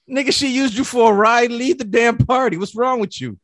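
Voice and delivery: amused mock scolding of a friend; mock exasperated. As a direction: read it like you can't believe him. Reading an exasperated text reply.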